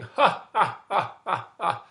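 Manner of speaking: loud acted belly laugh; big mocking "ha ha" laughter; lion cracking up. Lion cracking up at the little mouse, hammed-up laughing. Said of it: "The lion cracked up laughing".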